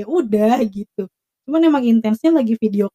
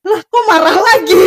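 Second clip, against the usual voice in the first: high, laughing mock exclamation; laughing disbelief; 7 semitones higher, 4 dB louder than usual. Telling a friend about the boyfriend's scolding, laughing disbelief. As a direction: retell your baffled reaction through laughter. Laughing retelling of own baffled reaction.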